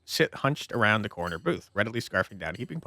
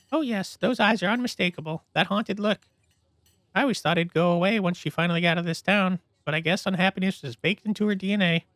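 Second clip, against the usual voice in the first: higher, catty gossip; gossipy owner; 8 semitones higher than usual. Acted. Diner owner gossiping with policemen, higher catty voice. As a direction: dish the dirt sweetly.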